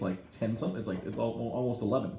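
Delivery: normal talking voice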